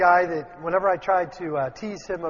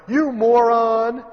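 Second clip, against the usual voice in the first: mock insult; 5 semitones higher, 9 dB louder, much slower than usual. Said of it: "Sometimes this happens in families".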